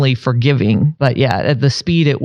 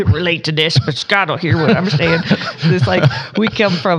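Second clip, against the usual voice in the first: put-on old lady voice; 4 semitones higher than usual. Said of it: "I'll put my old lady voice on".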